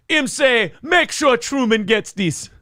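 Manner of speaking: slow, high comic Jamaican voice; Jamaican accent. Acted. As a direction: stretch it out in Jamaican. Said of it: "Him's going to Jamaica".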